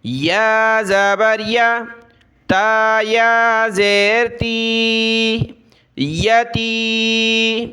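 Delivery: measured sing-song letter-by-letter recitation; slow classroom recitation drill; Qaida spelling drill. Shown as a demonstration. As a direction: chant each syllable, then blend and stretch the word.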